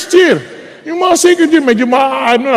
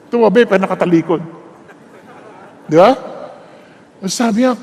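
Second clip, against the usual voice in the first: usual speaking voice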